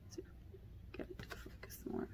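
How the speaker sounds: breathy whispered aside. Real, not acted. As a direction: whisper it under your breath.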